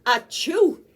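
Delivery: loud, rising achoo; a big hearty sneeze. Acted. Tiger giving a big sneeze, loud.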